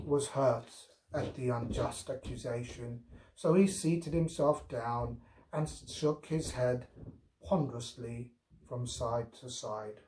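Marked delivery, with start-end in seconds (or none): none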